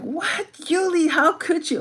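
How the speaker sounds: high-pitched scandalized mock outcry; playfully appalled, voice shooting up; mock shock. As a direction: act out a friend's shocked reaction. Imagined shocked reaction of viewers.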